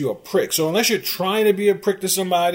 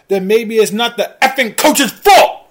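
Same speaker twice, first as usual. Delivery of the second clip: real frustration boiling over, much louder; exasperated outburst; 6 semitones higher, 9 dB louder than usual. Real, not acted. Host ranting at fans who blame the coach, exasperated outburst. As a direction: build to a loud, high-pitched shout.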